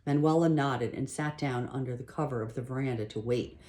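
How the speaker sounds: ordinary delivery, nothing marked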